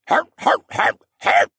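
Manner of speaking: loud harsh hacking noises; creature horking phlegm. A narrator's reading. Creature readies phlegm to spit, hacking loudly. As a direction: hork it up in short monster bursts. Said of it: "it horked the phlegm".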